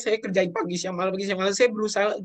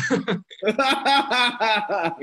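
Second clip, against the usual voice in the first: a bright burst of laughter, higher and louder; short laugh; 7 semitones higher, 5 dB louder than usual. Real, not acted. Guest laughing to the host about his savings, bright laugh. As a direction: laugh it off at your own plans.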